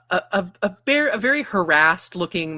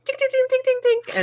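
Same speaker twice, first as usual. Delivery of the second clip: very high, light tink tink; high tinkling clink sound. A narrator's reading. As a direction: imitate tiny cups clinking. Imitating cups clinking.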